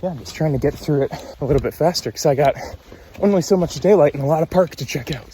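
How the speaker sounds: panting mid-run, fast; out of breath. Real, not acted. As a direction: talk breathlessly between strides. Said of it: "hence why I'm out of breath".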